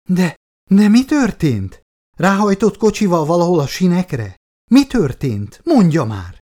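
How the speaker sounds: high, loud alarmed demand; shouting into the phone. A narrator's reading. Woman demanding news of her husband from the caller, shouted. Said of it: "kiáltotta bele a nő a telefonba".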